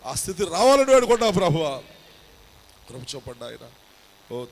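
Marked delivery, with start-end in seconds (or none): 0.1-1.7 loud, high, impassioned intercession; fervent prayer. Acted. Pastor praying over the live audience, loud and fervent. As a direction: cry out to God with full force. Louder plea.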